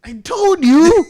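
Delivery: high, loud, slow I-told-you-so; gloating shout. Real, not acted. Gloating to a co-host who praised Solo, a loud slow crow. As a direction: crow it at your co-host, drawn out.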